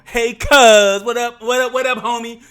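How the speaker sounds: put-on the old friendly cousin vibe; loud, high "hey cuz" before the fight; friendly hype. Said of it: "shift gears from".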